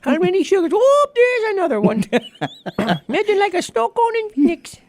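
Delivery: put-on high old lady falsetto; Mrs. Doubtfire. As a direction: squeal like a frazzled old nanny. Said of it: "Missus Doubtfire".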